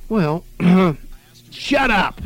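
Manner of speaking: put-on rude kids. A narrator's reading. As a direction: snap back like a bratty kid. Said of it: "they would say".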